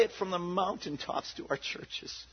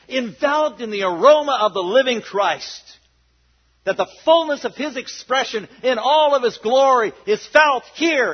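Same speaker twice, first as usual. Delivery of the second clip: preaching at full force; 6 dB louder than usual. Real, not acted. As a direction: proclaim it to the whole church. Preaching climax to congregation.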